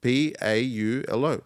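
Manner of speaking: "P A U L O." each letter slow and separate; spelled out slowly. Shown as a demonstration. Asked to spell it, slowly spelling "P A U L O.". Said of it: "Spell it".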